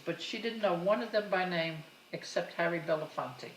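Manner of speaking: ordinary talk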